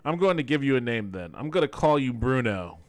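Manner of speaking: ordinary delivery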